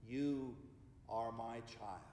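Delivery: low, soft God voice, word by word. Acted. God speaks to the whole world in closing, low and hushed. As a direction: speak as God, dying away with long pauses. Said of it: "God is speaking".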